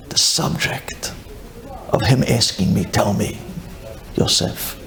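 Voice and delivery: low, hushed half-whisper, as if afraid to be overheard; scared hush. Retold or imitated. Yosef dreading his father's question, in a scared hushed voice. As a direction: murmur it like a frightened secret. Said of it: "'cause he was scared".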